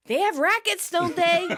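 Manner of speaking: high-pitched snarky retort; Monica, snarky. Retold or imitated. Monica dismissing Chandler's plea for a chance, snarky. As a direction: imitate a snark, pitched well up. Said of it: "Monica snarks".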